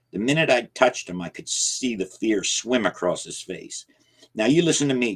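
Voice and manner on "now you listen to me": higher and louder, stern; stern warning to the old man; firm command. Acted. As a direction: lay down the law. Narrator confronts Jacob firmly.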